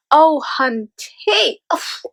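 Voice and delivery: sassy. Real, not acted. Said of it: "sassy reply to zodiac question".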